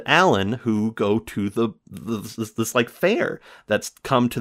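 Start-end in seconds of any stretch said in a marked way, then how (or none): none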